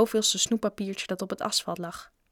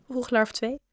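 ordinary delivery, nothing marked

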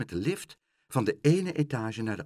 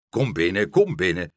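loud welcoming call; hearty call; 3 semitones lower, 7 dB louder than usual. A narrator's reading. Father Stamper welcomes Pluk in, loud and hearty. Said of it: "riep vader Stamper".

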